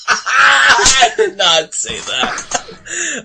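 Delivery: laughing denial, shouted and slow. Real, not acted. Denying a joke while laughing.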